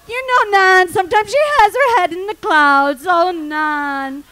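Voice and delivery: gossiping mourner. Retold or imitated. Funeral guest talking about spacey nun, loudly lamenting. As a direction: play a mourner tutting over the departed.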